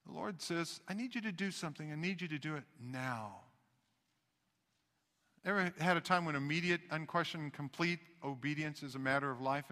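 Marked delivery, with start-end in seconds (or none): 0.9-3.4 soft, low-key insistence; quiet command. Acted. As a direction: give a hushed, firm order. Voices the Lord's grave command.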